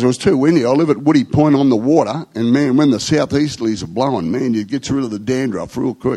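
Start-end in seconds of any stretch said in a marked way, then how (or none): none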